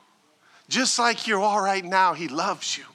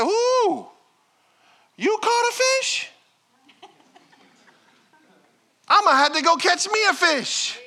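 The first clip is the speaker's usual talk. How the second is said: excited friend; 11 semitones higher, 4 dB louder than usual. Acted. Voiced friend excited at church.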